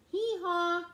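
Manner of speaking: loud braying hee-haw like a donkey. Acted. The donkey in the song braying, loud. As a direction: bray it out, loud and high.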